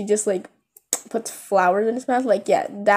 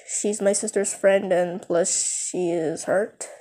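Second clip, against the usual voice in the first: ordinary delivery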